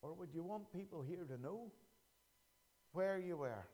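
dropped low and soft, almost confiding; hushed. Acted. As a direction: lean in and ask it softly. Hushed probing question.

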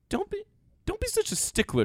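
mock whiny teasing. Host teases his cohost Daniel for rushing, in a high mock whine.

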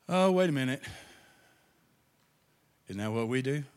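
ordinary delivery

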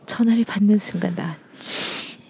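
unvoiced sultry whisper. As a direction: breathe it softly into the phone. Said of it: "전화 목소리로".

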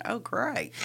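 sarcastic. Retold or imitated. Sarcastic inner thought about partner.

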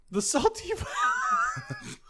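very high, slow squeal; silly high voice. Pretend. Friend repeating a crude new nickname, high silly squeal. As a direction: stretch it out in a squeaky mock cry.

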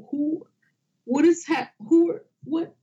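high, startled stammer of confusion; confused surprise. Retold or imitated. Reacting to men walking out of cave, startled and confused. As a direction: blurt out lost and startled.

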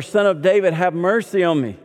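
loud pleading cry; begging shout for mercy. A narrator's reading. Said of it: "he cried out all the more".

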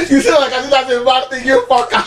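high, laughing comeback; playful insult through laughter; laughing retort. Real, not acted. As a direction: fire it back while laughing. Laughing reply to the teasing.

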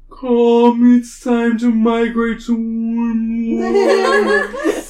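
slow, drawn-out whale call. Acted. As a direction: sing it out like a calling whale. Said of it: "I did it with the whale voice".

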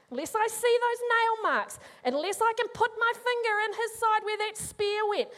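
doubting disciple demanding proof, defiantly; stubborn skeptical Thomas. Acted. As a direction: insist on proof, dragging out the last words. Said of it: "He folds his arms, frowns".

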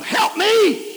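sudden high, loud cry to God; cry for help. Real, not acted. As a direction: break out into a desperate plea. Crying out to God in prayer.

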